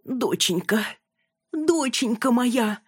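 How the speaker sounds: high, drawn-out motherly plea; trembling voice. A narrator's reading. Mother calling her unconscious daughter, trembling. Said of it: "услышала Яна дрожащий и до боли знакомый голос".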